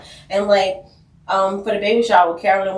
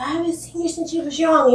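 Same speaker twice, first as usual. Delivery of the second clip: higher, hearty fatherly greeting; dad meeting his daughter, pitched up and hearty; 8 semitones higher than usual. Retold or imitated. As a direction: greet her like a delighted dad. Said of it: "he was like".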